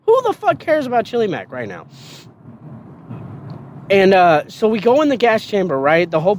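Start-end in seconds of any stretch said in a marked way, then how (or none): 0.0-1.9 high-pitched, exasperated who-cares rant; retold exasperated outburst. Recruit about to be gassed, fed up with questions, high exasperated outburst. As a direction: throw your hands up, voice shooting higher. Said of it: "I'm like".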